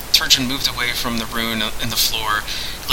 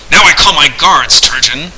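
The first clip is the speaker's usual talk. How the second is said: louder, higher scornful announcement; contemptuous; 3 semitones higher, 6 dB louder than usual. A narrator's reading. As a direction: lord it over him with contempt. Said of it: "announced Candide contemptuously".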